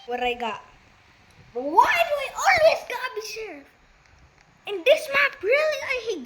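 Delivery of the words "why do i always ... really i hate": much higher, whining protest; exasperated high whine at the game; whiny complaint. Real, not acted. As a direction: moan like it's so unfair. Complaining about getting sheriff.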